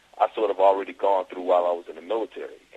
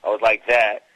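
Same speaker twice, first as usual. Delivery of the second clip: louder, higher New York accent; 3 semitones higher, 6 dB louder than usual. Shown as a demonstration. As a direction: put on a brash New York accent, with attitude. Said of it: "I had that New York accent".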